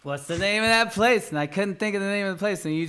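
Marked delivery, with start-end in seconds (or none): 0.0-1.3 high, loud, urgent repeated question; racking the brain out loud; mock frustrated recall; frantic searching. Retold searching for a name.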